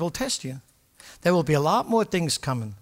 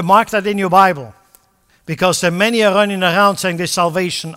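louder, higher, forceful exhortation; preaching at full force; 4 semitones higher, 9 dB louder than usual. Acted. Preacher urging the congregation to persevere, at full force. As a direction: drive it home like a fired-up preacher.

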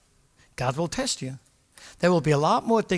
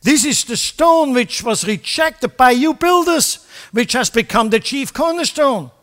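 louder, higher, forceful exhortation; preaching at full force; 8 semitones higher, 8 dB louder than usual. Acted. Preacher urging the congregation to persevere, at full force. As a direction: drive it home like a fired-up preacher.

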